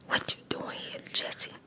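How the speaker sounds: hushed unvoiced whisper. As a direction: breathe it out like a secret. Retold movie scene, whispered.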